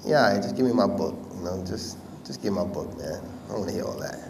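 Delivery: low, muttered dismissal; brushing someone off. Retold or imitated. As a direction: mumble it like you want out of the conversation. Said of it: "I'm looking at him like".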